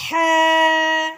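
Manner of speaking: ہے from mid-throat, loud and breathy; throaty ha. Shown as a demonstration. Teacher showing pupils the throat letter. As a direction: push the ہے from the throat. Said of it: "اگلا حرف ہے ہمارا".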